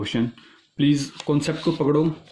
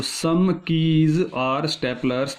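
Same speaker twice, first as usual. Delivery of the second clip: "some keys are staplers" drawn out word by word; slow deliberate repeat; much slower than usual. Shown as a demonstration. Teacher repeats the statement while drawing the diagram, slow and deliberate.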